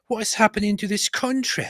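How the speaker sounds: a hint of a scandalised Brit; mock outraged Briton. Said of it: "the reaction from the British people will be".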